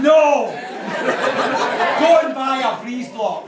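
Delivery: loud, high, laughing no; emphatic shout. Real, not acted. Best man warning the guests off skateboards, loud laughing shout. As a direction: bark the warning at the room.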